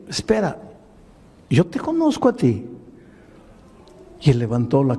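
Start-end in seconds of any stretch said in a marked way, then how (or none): 0.1-2.6 surprised recognition. Retold or imitated. Pastor recognizes the man.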